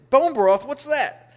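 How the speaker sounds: mock puzzled butcher. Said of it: "And she said".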